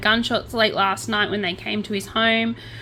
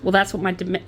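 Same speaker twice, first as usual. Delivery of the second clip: ordinary delivery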